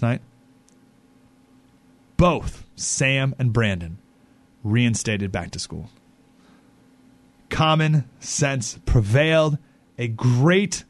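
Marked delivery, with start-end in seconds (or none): 2.2-9.6 slow, weighty delivery with long pauses; slow dramatic announcement. Real, not acted. Host breaking the good news to listeners, slow and weighty. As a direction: let each word land, slowly.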